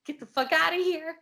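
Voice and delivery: loud, high mock-terrified yell; retold panicked order. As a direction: drive it away in imitated panic. Retelling terrified order to apparition.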